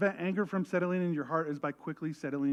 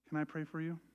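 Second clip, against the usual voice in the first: normal talking voice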